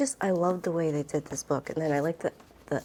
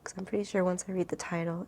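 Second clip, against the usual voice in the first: ordinary talk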